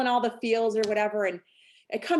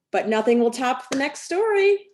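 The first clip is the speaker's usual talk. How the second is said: ordinary delivery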